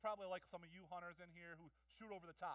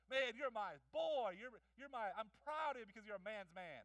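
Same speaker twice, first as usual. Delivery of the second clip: proud father; 4 semitones higher, 7 dB louder than usual. Pretend. Father voiced praising his son.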